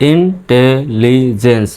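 Intelligence slowly, as "in, te, li, jens"; broken into small chunks. Shown as a demonstration. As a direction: gaps between the syllables of intelligence. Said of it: "ছোট ছোট করে ধরলাম".

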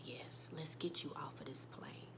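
put-on whispered comfort to a little girl; tearful whisper. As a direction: hush it gently through tears. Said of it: "her eyes tear up".

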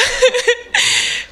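squealing laugh at a funny memory; real laughter mid-eulogy; high burst of laughter. Real, not acted. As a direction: let a high laugh escape.

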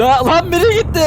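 bursting into high laughter; laughing. Real, not acted. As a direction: crack up mid-sentence. Car flips, laughing. (kahkahayla gülerek)

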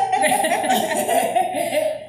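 big high laugh; loud burst of laughter. Real, not acted.

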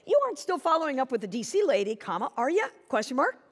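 "You aren't still following up" rattled off fast, ending on a lifted "are ya?"; quick rushed read. Shown as a demonstration. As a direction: toss it off fast and casual. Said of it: "why I even asked her that question".

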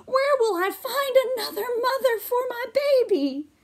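very high, worried mouse voice; anxious high mouse mother; fretting mother mouse. Acted. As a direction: squeak it out in worry. Mother mouse frets in story.

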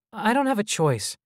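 put-on high-pitched child's voice; child hologram. A narrator's reading. Child hologram answering the visitors, high and childlike. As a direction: speak high, as a little boy.

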